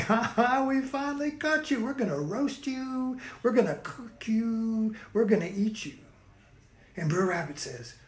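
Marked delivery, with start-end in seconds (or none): 0.1-5.9 higher, mocking triumph, ha ha and all; gloating villains. Bear and fox gloating to the caught rabbit, mock triumphant. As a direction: crow over the trapped rabbit.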